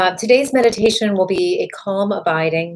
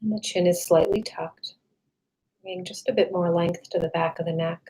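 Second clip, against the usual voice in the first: calm meditation voice with long, restful pauses; guided meditation; 4 dB quieter than usual. Real, not acted. Meditation guide leading listeners to relax the body, calm and unhurried. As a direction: guide them gently through a body scan, settling softer as it goes.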